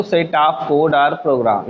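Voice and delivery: slow, word by word, for notes; deliberate dictation pace. Shown as a demonstration. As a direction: dictate it slowly so they can write. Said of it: "definition to note down".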